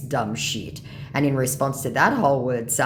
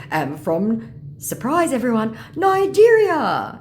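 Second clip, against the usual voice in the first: loud, high, drawn-out announcement; mock big reveal; 10 semitones higher, 7 dB louder, slower than usual. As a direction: announce it like a grand surprise. Mock reveal of scammer's origin.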